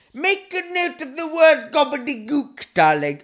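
mock-grand instruction to a darling; posh aside.

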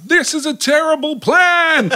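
high, slow mock yell; imitating a shout. Retelling the actor running for the door, high mock shout. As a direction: ham up the actor's panicked cry. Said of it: "he's shouting out".

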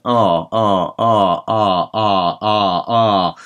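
ah as the mouth closes; change ah by the mouth shape; ah with the mouth closing; ah closing and opening. Shown as a demonstration. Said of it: "as I close my mouth".

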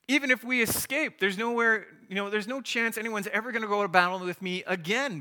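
hammed-up alarm at a suicide mission; incredulous panic. Gideon protests to God about his tiny army, loud, high, disbelieving panic.

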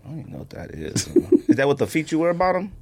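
usual speaking voice

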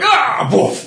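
loud high shout; startled shout. Acted. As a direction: jump out with a yell. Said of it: "they're like".

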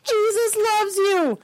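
high, drawn-out preachy cry; mock protester call. Protester calling to passing drivers, high mocking call. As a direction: stretch it out high like a heckler.

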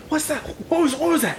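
high, hushed and urgent; soft, high-pitched alarm; distant startled voices. Acted. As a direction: voice a stranger overheard from afar. Game master voices approaching strangers' voices.

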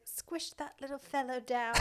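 put-on a light made-up Bunbury accent, softly; lightly voiced small-town quip in a Bunbury accent; gentle mock Bunbury accent. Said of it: "That was a good Bunbury accent".

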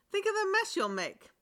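troll in a panic. Acted. Troll protests to Boots about the stream, panicking. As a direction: squeal it in a panic. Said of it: "said the troll in a panic".

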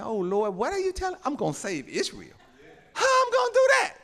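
acted disbelief leaping into a high squeal; incredulous. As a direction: balk at the order, pitch shooting up. Acting out Gideon doubting God's order.

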